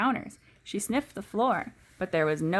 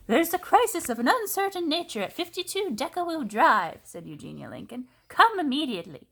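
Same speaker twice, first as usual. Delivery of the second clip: high, louder bossy phone call; brisk Eugenia; 8 semitones higher, 3 dB louder than usual. A narrator's reading. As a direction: order the fire department about. Eugenia phones fire department.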